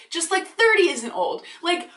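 ordinary talk

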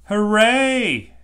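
loud, high, drawn-out cheer; big jubilant shout. A narrator's reading. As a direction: cheer like a whole group of children. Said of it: "Everyone cheers".

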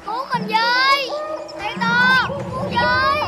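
calling for help. Acted. Poisoned friend calls for help.

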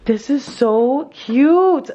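gasp, then slow, drawn-out gushing; stunned delight after a sharp intake; overwhelmed, in shock. Real, not acted. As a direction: stretch it out, close to tears. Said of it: "I'm a little bit in shock".

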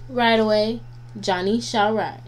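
plain reading voice

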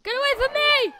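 high, loud fending-off cry; alarmed shout. Real, not acted. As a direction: yell to drive it away. Fending off the monster.

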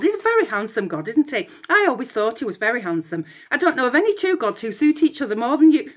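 fast, chirpy chatter; quick gushing prattle; babbling on. Acted. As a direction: rattle on without a breath. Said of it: "Echo babbled".

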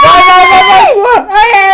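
very loud, high cheering yell; cheering shout. Real, not acted. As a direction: yell them on to push. Cheering push in game.

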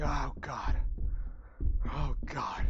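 usual speaking voice

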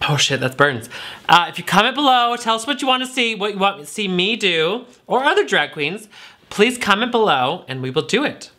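usual speaking voice

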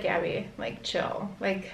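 soft whispered self-calming; whispered pep talk to herself. Retold or imitated. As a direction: talk yourself down in a hush. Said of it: "And I was just like".

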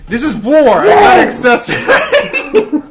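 high, slow, laughing battle cry; excited shouting. Real, not acted. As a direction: yell it like going to war, laughing. Hyped up over outfit choice.

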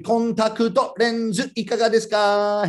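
sing-out street promoter's pitch, held on one note; chanted sales call; tissue hander's call. Acted. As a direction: call it out to passers-by, stretching the end. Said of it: "Contact lenses would be".

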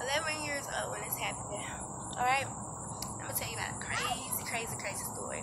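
hushed, breathy storytelling aside; secretive whisper to the viewers. Real, not acted. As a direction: lean in and whisper the setup.

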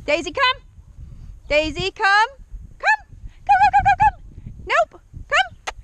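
high, loud recall call; calling the dog. Real, not acted. Trainer calling Daisy to her, high and urgent. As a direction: urge the dog in with rapid high repeats.

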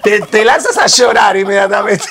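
high, loud hammed-up Argentine wail with laughs; mock Argentine weeping. Joking that Argentines throw themselves to the floor crying, hammed-up wail. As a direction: ham up tearful Argentine drama. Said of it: "Este acento te trae depresión".

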